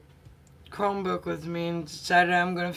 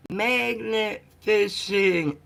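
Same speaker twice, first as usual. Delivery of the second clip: louder, slow insistence; correcting a stranger by the river, louder and slow; insistent reply; 4 semitones higher, 4 dB louder, much slower than usual. Retold or imitated. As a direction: spell it out for her. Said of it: "I'm like".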